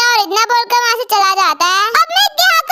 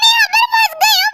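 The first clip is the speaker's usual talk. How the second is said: desperate shout for rescue; calling out for help; 9 semitones higher than usual. Acted. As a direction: shout it out so someone hears. Calling out, trapped in library.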